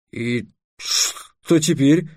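very slow, cautious question; wary. Acted. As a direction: ask it guardedly, dragging the words. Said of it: "насторожился Трикс". (настороженно, медленно)

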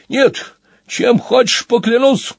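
high, drawn-out frightened plea. A narrator's reading. Madge swears to the accusing wolf, frightened. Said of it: "В страхе выпалил Мадж".

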